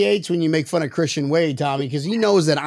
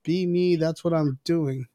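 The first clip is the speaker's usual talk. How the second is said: quieter, quoted aside; soft quote; 8 dB quieter than usual. Retold or imitated. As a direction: voice someone's earnest message softly. Acted quote of Joey Hatch Junior.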